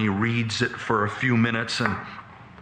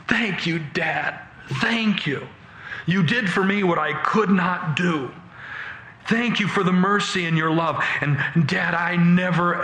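high, emotional thanks, lightly acted; mock-tearful gratitude; 6 semitones higher than usual. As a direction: pour out thanks as if starting to cry. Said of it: "Now I begin to cry".

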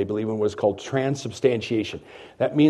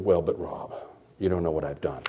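doubting listener; 8 semitones lower, 8 dB quieter than usual. Retold or imitated.

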